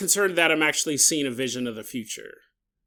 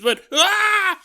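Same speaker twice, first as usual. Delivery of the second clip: mock scream; 5 dB louder than usual. Laughing scream.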